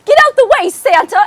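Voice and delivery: loud, high-pitched acted yell; shouted line. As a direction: yell the line out urgently in imitation. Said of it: "he has the line".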